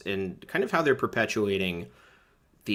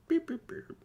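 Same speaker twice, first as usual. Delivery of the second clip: high beeps like a bleep tone; censor bleep; 8 dB quieter than usual. Pretend.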